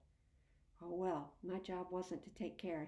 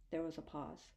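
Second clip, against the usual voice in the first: plain reading voice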